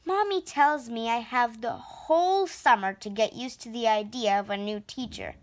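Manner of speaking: usual speaking voice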